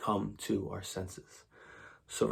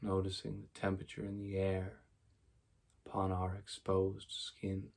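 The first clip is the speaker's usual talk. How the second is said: soft, even meditation voice with long pauses; quiet, unhurried mindfulness guidance; guided meditation; 10 dB quieter than usual. Real, not acted. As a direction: guide them gently into stillness, leaving space after each phrase.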